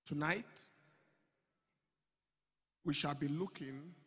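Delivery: ordinary delivery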